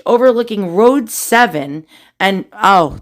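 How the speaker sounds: usual speaking voice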